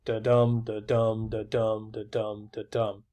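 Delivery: "da-dum" five times, weak DA, stressed DUM, evenly metered; robotic iambic pattern. Shown as a demonstration. Lecturer demonstrating iambic pentameter to students, "da-dum" in robotic even beats, stress on DUM. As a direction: chant "da-dum" like a metronome, every DUM stressed. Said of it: "robotic as that".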